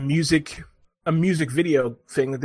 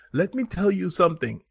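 imitated Ugandan accent; 3 semitones higher, 4 dB quieter than usual. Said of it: "this whole thing in the accent".

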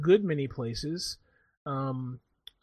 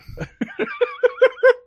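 loud laugh; 12 dB louder than usual. Real, not acted. Laughing at the joke.

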